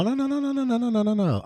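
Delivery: high sing-song run of no; playful no. Real, not acted. Brushing off a viewer's remark, a high playful sing-song no. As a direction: toss off the nos lightly up high.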